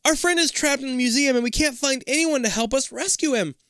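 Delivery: high, rushed desperate plea; panicked plea. Acted. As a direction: beg for help in panic.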